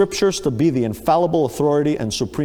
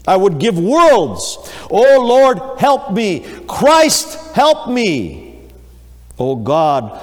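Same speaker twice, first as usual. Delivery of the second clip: higher and louder, pleading in terror; desperate cry for help; 5 semitones higher, 5 dB louder, much slower than usual. Acted. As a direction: cry out like a man facing death. Dying man crying for help.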